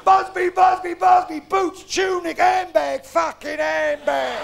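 loud, barked kit inspection; bellowed drill roll-call, last word stretched; mock drill sergeant. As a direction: shout each item like a parade order. Guardsman kit listed as parade drill.

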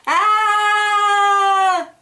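high, loud terrified scream. Acted. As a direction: let out a panicked holler. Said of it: "I screamed and hollered".

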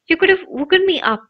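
quick, mildly cross complaint; lightly voiced cross boy. A narrator's reading. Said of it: "said Ron crossly".

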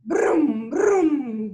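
loud engine brum; truck engine rumble. Acted. (motor de camión)

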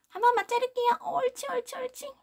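high, sweet coaxing to a dog; high sing-song puppy coaxing. Real, not acted. Coaxing dog during nail trim.

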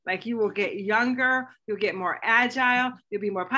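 ordinary talk

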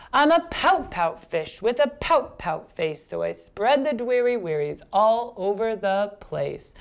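put-on slow, heavily accented rhythmic refrain; gloomy pout-pout fish. Fish tells of his pouty face, slow gloomy chant. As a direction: chant the fish's woe, stretching the ending.

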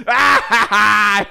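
very high, loud mock laugh; imitated cackle. Undercutting the "most beautiful voice" story, loud mock cackle. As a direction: let out a piercing put-on cackle. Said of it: "you just hear her go".

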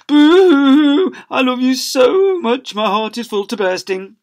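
high, loud hammed-up blubbering; weeping with joy. Father weeping at son's praise, loud mock blubbering. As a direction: sob out the love in comic floods. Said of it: "burst into floods of tears".

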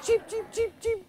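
high, laughing chick cheeps; baby chick peeping. Pretend. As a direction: peep like a tiny chick through a laugh. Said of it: "that's the little chick".

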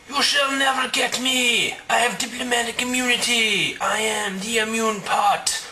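high, shouted gloating villain voice; sneering panto villain. Acted. Villain taunts the officer.

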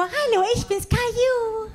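high, childlike cartoon voice; childish cartoon kid greeting; Caillou voice. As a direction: squeak it like a little cartoon boy. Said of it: "Kennt ihr den Zeichentrickfilm?".

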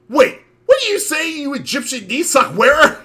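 high, loud alarmed outburst. Acted. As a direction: blurt it out shocked.

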